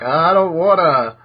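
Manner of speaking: put-on slow, childish whine; mock whine. Natsu and Gray refusing Erza's bath order, slow whine. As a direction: drag it out like a sulking kid.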